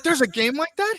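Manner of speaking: high-pitched retold disbelief; incredulous. Retelling his discovery of the game, incredulous. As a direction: squeak it out in comic amazement.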